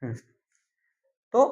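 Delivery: ordinary delivery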